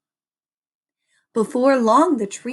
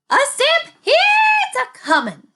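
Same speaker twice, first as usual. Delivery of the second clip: high, loud alarmed cry; cried out; 7 dB louder than usual. A narrator's reading. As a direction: cry out the warning. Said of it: "cried Mary".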